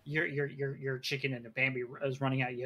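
ordinary delivery

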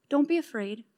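soft, settled reassurance; calm. Acted. Elisha turns to his panicking servant, calm and soft.

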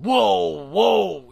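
loud, high, drawn-out yell; yelling whoa. Retold or imitated. Rider yelling at a mule to stop, loud and urgent. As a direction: holler it like a panicked rider. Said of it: "and they're yelling".

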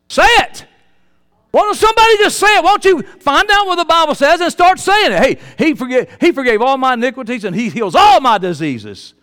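loud, high exhortation; urgent preaching. Acted. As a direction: push them to speak it out. Urging the congregation.

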